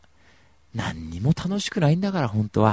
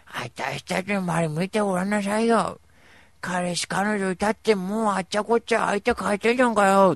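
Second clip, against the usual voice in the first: camp friend voice; 9 semitones higher, 5 dB louder than usual. Retold or imitated. Voicing friend うーちゃん scolding.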